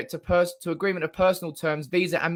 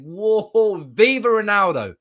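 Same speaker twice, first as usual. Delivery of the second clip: louder and higher cheer; 4 semitones higher, 7 dB louder, slower than usual. Real, not acted. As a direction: shout it like a terrace cheer. Fan chant celebration.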